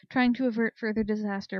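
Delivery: plain reading voice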